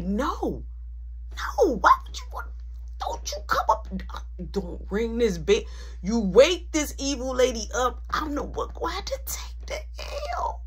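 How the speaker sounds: high frantic whispered pleading; pleading with friend not to wake mom; panicked pleading. Retold or imitated. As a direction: beg in a strangled half-shout. Begging friend not to ring.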